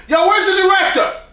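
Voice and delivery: loud dumb shout for the director; real loud and stupid. Shown as a demonstration. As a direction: holler for the director like a fool. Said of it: "so I'll talk real loud".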